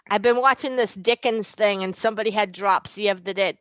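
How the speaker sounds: ordinary talk, nothing marked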